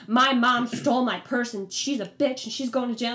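hammed-up outraged accusation; imitated angry rant. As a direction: mimic a cellmate ranting about her mom. Acts out another inmate's angry rant.

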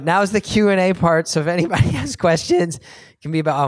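real crack in the voice while addressing the room. Real, not acted. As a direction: let the voice break slightly mid-sentence. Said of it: "my voice is cracking".

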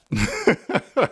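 brief high chuckle; laugh. Real, not acted. Teacher amused by out-of-breath example, laughing. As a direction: chuckle at your own demonstration.

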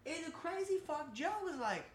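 high, excited retelling; getting worked up about the show; squealing about the twist. Real, not acted.

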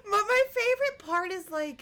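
high laughing words. Real, not acted. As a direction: laugh while speaking. Laughing at story.

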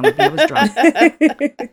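laughing while talking. Real, not acted. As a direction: laugh through the words. Laughing at own mistake.

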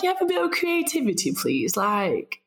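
high mock-exasperated plea for creativity; teasingly scold, pitched high; exasperated retort. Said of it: "I'm just like".

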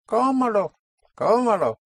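Cómalo, high stressed CÓ, then a clearly sounded A; clear unstressed vowel. Shown as a demonstration. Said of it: "clearly pronouncing the A or the E".

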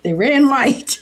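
repeated through laughter; giggling repeat; laughing. Real, not acted. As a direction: laugh while quoting the line.

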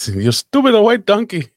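put-on high-pitched, outraged Indian-accented shopkeeper; mock Indian shopkeeper yelling. Store clerk catches a shoplifter, mock-angry Indian accent. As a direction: scold in a hammed-up Indian accent. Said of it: "when the guy yelled at me".

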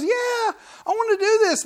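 very high, gleeful child impression; excited kid. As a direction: squeal it like a kid raring to ride. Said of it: "the kids goes".

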